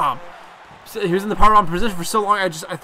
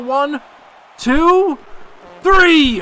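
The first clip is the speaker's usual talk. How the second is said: excited pinfall count; 8 semitones higher, 7 dB louder, much slower than usual. Pretend. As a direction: count climbing high and loud. Calling the winning pinfall count.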